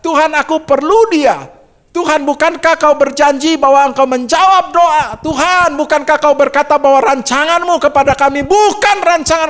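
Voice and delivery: lamenting husband pleading with God; anguished prayer. Retold or imitated. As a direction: cry out to God in retold grief. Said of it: "Saya begitu sedih".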